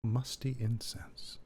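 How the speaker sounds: slow breathy whisper. Pretend. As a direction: hush it out slowly, savoring. Player repeats the smell mysteriously.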